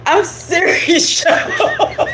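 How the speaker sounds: high squealing laugh through the words; can't stop laughing at herself; laughing hard. Real, not acted. Streamer cracking up at her own words.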